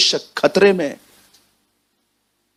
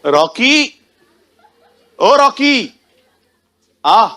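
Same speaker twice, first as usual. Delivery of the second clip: rising high calls across the house; calling out; 6 semitones higher, much slower than usual. Retold or imitated. Mother calling her son Rocky to come, rising high calls.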